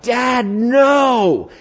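high drawn-out cry of protest; frightened, protesting son. Acted. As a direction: plead with your father to stop, stretching it high. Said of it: "a son that's like".

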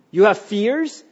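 usual speaking voice

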